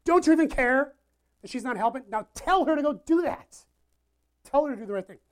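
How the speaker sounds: high, loud bossy demand; loud scolding orders; ordering around. Acted. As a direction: boss him around like a big sister. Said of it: "she orders him around".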